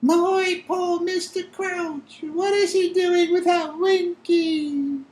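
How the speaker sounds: very high, slow, tearful elf voice. Acted. Winky lamenting her master in a high elf voice. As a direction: lament in a tiny elf squeak. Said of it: "tears leaking down her face".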